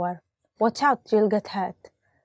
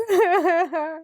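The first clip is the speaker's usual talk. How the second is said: mock sob; 8 semitones higher than usual. Mock sob after ripped jeans.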